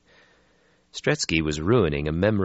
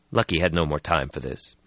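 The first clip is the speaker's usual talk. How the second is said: ordinary delivery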